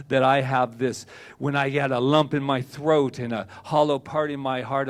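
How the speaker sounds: normal talking voice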